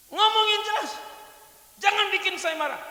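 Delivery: high, loud threatening demand; angry father. Acted. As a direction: warn her you're about to blow. Father threatens his daughter.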